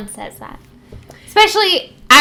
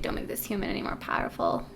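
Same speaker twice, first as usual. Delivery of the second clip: hushed, breathy and much softer; whispered scoff; 4 semitones lower, 18 dB quieter than usual. Retold or imitated. Oriana scoffing at Jude, whispered. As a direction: mutter it under the breath in scorn. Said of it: "She's like".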